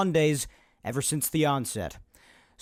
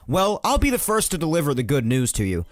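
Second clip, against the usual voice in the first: loud podcast open; 3 semitones higher, 5 dB louder than usual. Acted. Host opening his podcast, loud and hyped. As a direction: sell the show like a radio host. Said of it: "my loud open".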